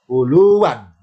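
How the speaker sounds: ULUWAN with long "luu", slow; original double wawu. Shown as a demonstration. Teacher shows the original form, slow long "luu". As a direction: hold the LU in ULUWAN before "wan". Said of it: "aslinya adalah".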